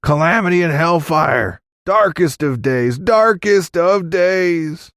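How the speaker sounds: high squawking doomsayer's holler; frantic shouting. A narrator's reading. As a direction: wail the alarm, stretching the last cry. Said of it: "shouted like the devil was at his back".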